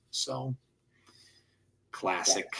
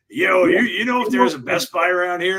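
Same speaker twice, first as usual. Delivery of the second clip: raised, louder imitated wrestler voice; Val Venus; 5 semitones higher, 4 dB louder than usual. Wrestler asking a stranger for directions, mock gravelly voice. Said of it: "this gravelly voice go to me".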